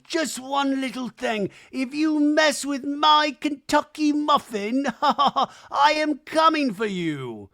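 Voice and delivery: high, slow, grand mock warning; imitating Lisa. Podcaster mimicking Lisa warning the cast at the wedding, high and slow. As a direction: ham up Lisa's threat in a high, slow voice. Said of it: "we see Lisa at the wedding going".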